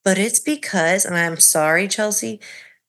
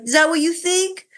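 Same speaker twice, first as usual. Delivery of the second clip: exasperated husband, pitched up; mock hurt, raised pitch; high exasperated mimic; 7 semitones higher, faster than usual.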